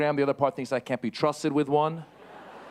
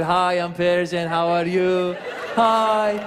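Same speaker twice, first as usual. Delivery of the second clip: slow, drawn-out sweet greeting; smiling, friendly Persian; 3 semitones higher, 4 dB louder, much slower than usual. Acted. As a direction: stretch it out with a big friendly smile. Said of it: "we smile when we say".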